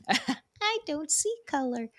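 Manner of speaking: high, soft mock-innocent voice; mocking repeat. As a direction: parody a sweetly oblivious person.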